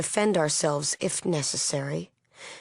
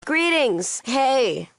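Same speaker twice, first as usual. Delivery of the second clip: high, loud call of welcome; hailing greeting; 8 semitones higher, 5 dB louder, much slower than usual. Acted. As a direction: call out to an arriving visitor. Calling out a greeting to a visitor.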